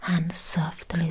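ordinary talk, nothing marked

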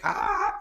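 high, short retold shriek of fright; mock scream. Kids at the Ouija board shrieking in retold fright. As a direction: yelp like a scared kid. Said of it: "And we were like".

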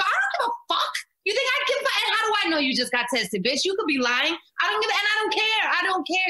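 high, fast, furious outburst; retold rant. Mom yelling at the hiker about her test, high and angry. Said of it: "Screaming at her".

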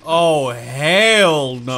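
loud, long-drawn appalled refusal. Real, not acted. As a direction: drag the words out loudly in dismay. Recoils at frightening game scene.

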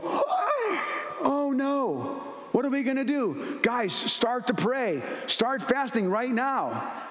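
squealing high mock panic that slows to a fret; a hammed-up frightened leader rallying the disciples. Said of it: "Jesus didn't go".

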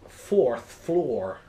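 emphatic mock New York reply; New York accent. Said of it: "the New Yorker with his accent said".

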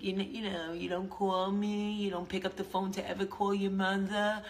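a hint of a guilt-tripping mom; nagging mother. Imitating her mother complaining she never calls. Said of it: "My mother's always like".

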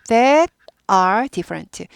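slow, clear model sentence; slow model reading. Shown as a demonstration. Teacher reading slowly to the class. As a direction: read it out slowly for students.

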